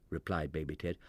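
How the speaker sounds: plain reading voice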